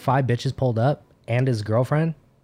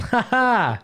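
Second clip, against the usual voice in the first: a quick high fake laugh; mock laugh; 9 semitones higher than usual. Mocking laugh while turning down a dance.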